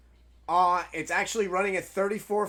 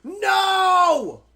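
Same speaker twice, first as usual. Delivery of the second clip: furious yelling at the game; raging gamer shout; angry shout; 10 semitones higher, 6 dB louder, slower than usual. Real, not acted. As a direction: yell it out in real rage. Raging at game, locked door.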